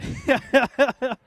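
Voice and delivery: loud, high burst of relieved laughter. Real, not acted. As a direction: laugh out the nerves. Laughs after ending his intro.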